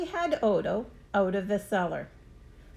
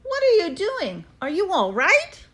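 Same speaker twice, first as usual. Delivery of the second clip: high, louder worried call; worried boy; 9 semitones higher, 5 dB louder than usual. A narrator's reading. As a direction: call out scared for him. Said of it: "I yelled".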